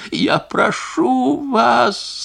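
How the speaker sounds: high, very slow, sobbing; sobbing plea. A narrator's reading. Wife sobbing as she begs the singer. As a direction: beg through sobs. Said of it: "слышалось сквозь её рыдание".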